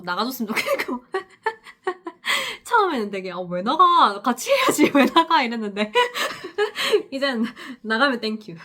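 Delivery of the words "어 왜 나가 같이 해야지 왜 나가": high, loud bewildered question with laughter; baffled early self. Retold or imitated. New nurse baffled the guardian leaves, high laughing disbelief.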